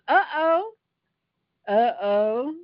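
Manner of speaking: sing-song high uh-oh, teasing; mock-worried uh-oh; playful uh-oh. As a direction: tease the crowd that something's coming. Preacher reacts to waters struck.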